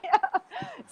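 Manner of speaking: bright high-pitched laugh. Real, not acted. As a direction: burst into a high giggle. Laughing at her own story.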